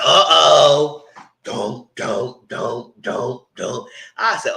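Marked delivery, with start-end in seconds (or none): 0.0-1.0 loud, dramatic retold uh-oh; hammed-up group alarm, much louder; mock alarm. Retells everybody's alarmed reaction. 1.4-3.9 voiced dun-dun-dun drumbeats; separate, evenly spaced dramatic thuds; mock suspense sting. Imitates a suspense music sting.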